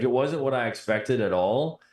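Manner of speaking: usual speaking voice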